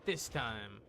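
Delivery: usual speaking voice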